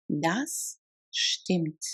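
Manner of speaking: slow, with a gap between words. Shown as a demonstration. Teacher models agreement in German, word by word. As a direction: set each word apart for a learner. Language drill, separated take.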